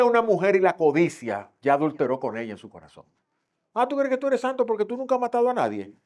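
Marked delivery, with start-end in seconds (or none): 3.7-5.9 mocking challenge. Mocking the self-righteous.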